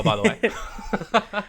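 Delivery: drawn out through a laugh. Real, not acted. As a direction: laugh the words out slowly. Laughing at the joke.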